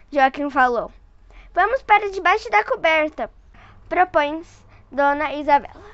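put-on lively read-aloud voice for a child; storyteller voice for a little one; story narration. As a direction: read it like a bedtime picture book. Reading picture book to child.

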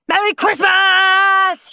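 high, slow, loud Christmas-morning shout. A narrator's reading. Henry wakes everyone on Christmas morning, shouting. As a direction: yell it out with glee. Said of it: "shouted Henry".

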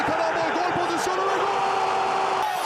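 drawn-out shouted goal call. Real, not acted. Goal call in match commentary.

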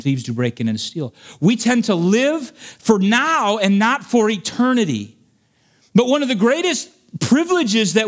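high, impassioned pulpit delivery; full-force preaching. Real, not acted. Preacher warns the congregation about living for now, rising full-force preaching. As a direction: preach it hard, pitch climbing as you build.

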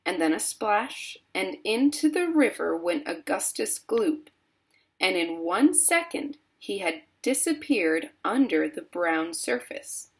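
plain reading voice

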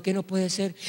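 soft, subdued acted query; puzzled question. Praying brother to the shouting man, soft puzzled question. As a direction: ask it quietly, not understanding.

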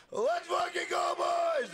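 high excited yell of triumph; pumped streamer hype call; hype shout. Real, not acted. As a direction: cheer the chat on at full pitch. Hype cheer for stream members.

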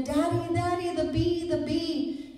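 high, alarmed child's cry, imitated; shouting little boy. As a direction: call out to Daddy like a frightened boy. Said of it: "the little boy started shouting again".

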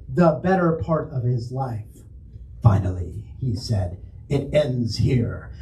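hammed-up dramatic narration with portentous pauses; William Shatner. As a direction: read it like a hammy starship captain. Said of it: "in a highly Shatner-esque fashion".

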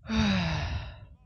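long, loud exhaled sigh; heavy sigh that falls away; annoyed sigh. Real, not acted. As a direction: let out a big frustrated breath. Annoyed at losing units.

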